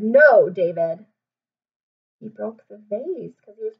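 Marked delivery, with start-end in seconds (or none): 0.0-1.1 louder, slower repeated scold; insist, no more chances; insistent scold. Shown as a demonstration. Teacher repeating the scold more firmly. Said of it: "I said".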